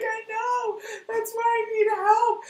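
high, loud, hammed-up whining protest; mock wail. Boss answering her yelling assistant, mock wail. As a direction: wail back in comic despair.

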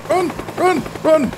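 high, frantic run run; panicking gamer squealing; panicked high yelp. Real, not acted. Chased in game, panicking.

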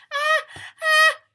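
loud, high pre-sneeze gasps; big rising ah-ah before a sneeze; sneeze build-up. Acted. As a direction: wind up for a huge sneeze.

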